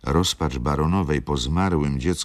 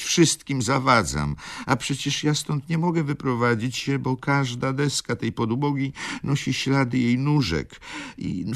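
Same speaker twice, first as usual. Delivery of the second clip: grieving baroness, pitched higher; baroness lament; 10 semitones higher than usual. Acted. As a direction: plead as a wronged, grieving mother.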